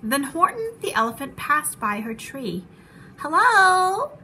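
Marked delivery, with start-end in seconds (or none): 3.2-4.2 high, loud, drawn-out hello; singsong call from a smiling bird; sweetly calling out. A narrator's reading. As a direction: call it out with a big smile. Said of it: "called the lazy bird, smiling her best".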